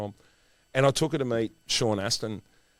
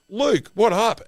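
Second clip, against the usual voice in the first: high, loud buddy hail; loud greeting; 13 semitones higher, 9 dB louder, slower than usual. Retold or imitated. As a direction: call out across the line. John DiMaggio greets them loudly.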